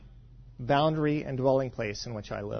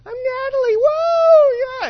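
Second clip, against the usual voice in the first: squealing way up high and loud with a cheer; giddy excited shriek; 14 dB louder, slower than usual. Retold or imitated. Wife picked for the game show, squealing with excitement. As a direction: burst out like a thrilled game-show fan. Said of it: "And she goes".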